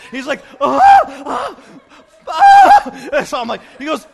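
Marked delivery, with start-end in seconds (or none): 0.6-3.1 repeated high, loud mock shrieks; panicked screams. Imitating the freaked-out passenger, bursts of shrill screaming.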